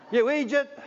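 high, loud Scottish jeer of you eejit; mock Scottish insult. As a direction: throw it like an angry local driver. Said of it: "I hear people call me that as I drive".

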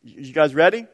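ordinary delivery

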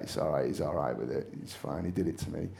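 a hint of a meek, mumbling director; mocking Ken Loach. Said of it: "take the mickey out of him".

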